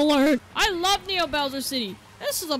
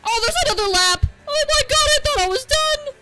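much higher and louder, genuinely caught off guard; shocked outcry; 12 semitones higher, 7 dB louder than usual. Real, not acted. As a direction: yelp it as the surprise hits. Surprised by an extra lap.

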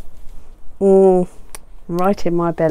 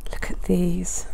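soft awed whisper; hushed, wonder-struck aside; 3 semitones lower, 9 dB quieter than usual. Real, not acted. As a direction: breathe it like a secret treasure. Hushed over pretty stickers.

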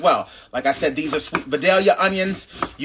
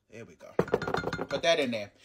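whispered relief; 4 semitones lower, 12 dB quieter, slower than usual. Real, not acted. Relief as the sauce comes out.

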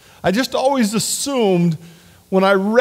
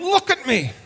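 high, softer plea for attention; pleading look at me; 9 semitones higher, 3 dB quieter than usual. Retold or imitated. David begging God to look at him. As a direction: beg to be looked at. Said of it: "picture David saying".

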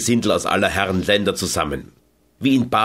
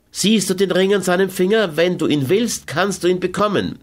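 Carmen, tempting; 9 semitones higher than usual. A narrator's reading. Carmen tempts her lover with the Englishman's ring, higher.

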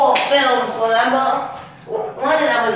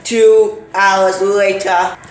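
dramatic narrator; 5 dB louder, much slower than usual. Pretend. Movie-style time skip announcement.

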